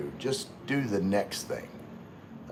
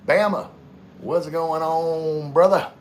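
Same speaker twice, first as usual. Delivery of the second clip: Gino-style greeting; 7 semitones higher, 7 dB louder, slower than usual. Retold or imitated. Greeting chat viewer in Gino voice.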